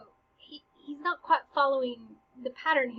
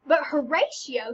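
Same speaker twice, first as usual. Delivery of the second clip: ordinary talk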